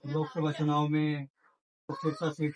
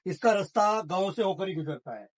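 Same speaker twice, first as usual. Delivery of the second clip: measured field-report delivery, a little higher; reporter to camera; 3 semitones higher than usual. Acted. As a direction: sum up the story like a TV reporter. Reporter addresses camera, explains election.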